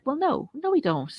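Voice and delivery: high, light mock refusal on the first no; a playful, breezy refusal to roll over; mock defiant no. Host retelling refusing to acquiesce, mock defiant. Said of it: "And so it's like".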